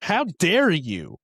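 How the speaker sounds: high, loud, slow mock indignation; hammed-up outrage. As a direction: draw it out, outraged and shocked. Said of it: "she was like".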